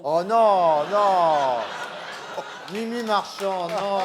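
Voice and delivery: loud, drawn-out horrified "no"; scandalised protest. Acted. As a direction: cut them off in loud mock outrage. Host scandalised by the indiscretion. (protestation scandalisée et sonore)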